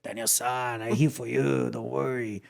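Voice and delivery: put-on soft, low Japanese-accented voice; hushed old-master Japanese accent; Miyagi. Host quoting the Karate Kid sensei back on screen, Japanese-accented impression. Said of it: "I was doing an accent".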